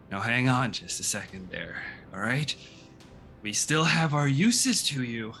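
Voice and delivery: lightly voiced nervous goblin boss; goblin leader voice. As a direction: plead smoothly, a touch nervous. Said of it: "and goes".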